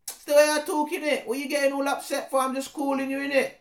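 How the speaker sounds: defensive roadman brushing off a complaint. Acted. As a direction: shrug it off with street swagger. Street-slang character voice.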